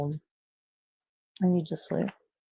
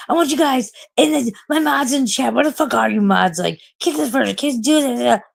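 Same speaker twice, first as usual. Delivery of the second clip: mock bossy orders in a higher voice; imitating bossy streamer; 6 semitones higher than usual. Mocking streamers who boss their mods, high bossy voice. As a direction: order them around like an entitled streamer. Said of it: "they treat their mods like, like bitch boys".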